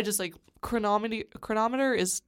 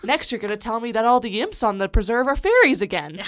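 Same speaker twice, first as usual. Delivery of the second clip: higher, louder scoffing disbelief; mock incredulity; 4 semitones higher, 9 dB louder than usual. Playing a character doubting wild news, mock incredulity. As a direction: build an exaggerated what-next protest. Quoting Patton's incredulous reply.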